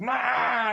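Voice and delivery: a sharp high cry of rage; frustrated yell. Retold or imitated. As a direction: let out a burst of pent-up anger. Adam banging on steering wheel.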